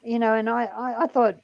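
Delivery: ordinary talk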